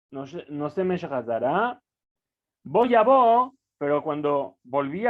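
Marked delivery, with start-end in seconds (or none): 2.6-3.5 bright joyful outburst, louder and higher; alegre, cantado. Retold or imitated. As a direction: burst out with joy like singing. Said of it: "venía cantando de alegría". (el toro vuelve cantando de alegría)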